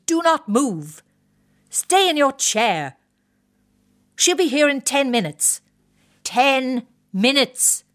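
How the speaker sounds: officer ranting at his wife about the hairdresser; exasperated wing commander. Acted. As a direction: hammer each order home at her. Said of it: "His desperation reached a pitch".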